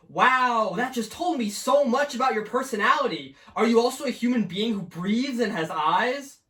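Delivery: high mocking sarcasm; sarcastic. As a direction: sneer at the bland profile. Sarcastic reaction to profile.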